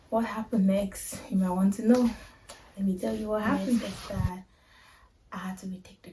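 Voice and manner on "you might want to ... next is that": ordinary delivery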